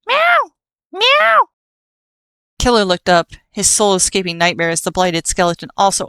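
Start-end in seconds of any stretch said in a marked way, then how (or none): 0.1-1.5 squeaky rising mew of a tiny kitten; high kitten meow. Acted. Narrator mimics a kitten mewing at the skeletons. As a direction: mew like a desperate little kitten. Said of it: "mewing at them in desperation".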